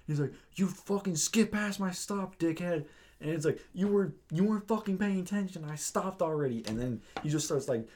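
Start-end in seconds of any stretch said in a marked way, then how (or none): 0.5-2.8 high-pitched mock outrage, drawn out; imitated angry yelling. Irate rider telling off the bus driver, high mock yell. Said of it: "he just yells to the bus driver". 3.7-6.6 high mock tirade at normal volume; retold yelling. Rider blaming the bus driver, high mock yell. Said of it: "he just yells to the bus driver".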